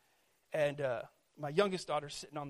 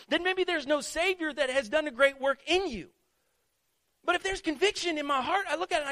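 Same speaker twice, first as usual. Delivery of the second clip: high, forceful sermon delivery; impassioned preaching; 10 semitones higher, 7 dB louder than usual. Real, not acted. Preacher urging listeners to feel convicted about foolish talk, impassioned. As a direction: drive it home to the congregation, pitched high and urgent.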